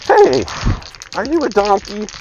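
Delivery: loud, high clown voice. Acted. Clown singling out a man in the crowd, loud and mocking. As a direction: call it out high like a taunting clown. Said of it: "the clown walks up to him and says".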